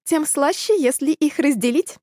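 sweet sly smile in the voice; smiling. A narrator's reading. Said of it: "улыбнулась кошка".

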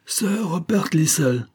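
high, slow call that rings through the hall; ringing call. A narrator's reading. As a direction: call his name out across the room. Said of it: "s'écria Olivia, dont la voix claire résonna".

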